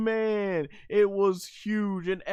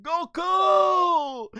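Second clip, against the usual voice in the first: high shouted Goku; mock anime cry; 11 semitones higher, 4 dB louder than usual. Bulma calling out, high mock shout. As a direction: yell his name like the anime girls. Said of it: "going like".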